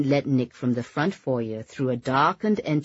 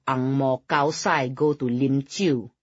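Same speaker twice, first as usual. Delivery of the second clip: put-on snooty Hokkien-accented quote; Hokkien phrase. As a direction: sneer it in Hokkien. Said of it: "the Hokkien phrase".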